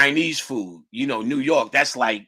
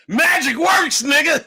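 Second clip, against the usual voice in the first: high, loud amazed yell; awestruck shout; 13 semitones higher, 7 dB louder than usual. Retold or imitated. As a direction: blurt it out in total disbelief. Awestruck outburst at seeing her.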